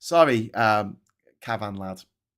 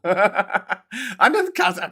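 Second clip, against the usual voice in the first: slow high laugh through words; 9 semitones higher, 6 dB louder, much slower than usual. Real, not acted. Streamer laughing at a viewer's jibe. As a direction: break up laughing mid-word.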